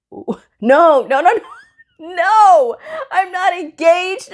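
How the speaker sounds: high, loud, half-laughing protest; emphatic denial. Retold or imitated. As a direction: shoot the rumor down, hammed up. Acted denial of engagement rumor.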